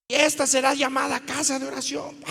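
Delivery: high, quick proclamation; mock righteous outburst. Preacher imagining himself expelling moneychangers with a belt, outraged. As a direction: thunder it as if clearing the temple.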